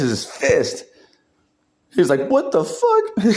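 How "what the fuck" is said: high shocked shout; mock squeal of shock. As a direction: yelp it in disbelief. Fist caught, shock.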